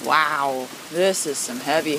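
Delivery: usual speaking voice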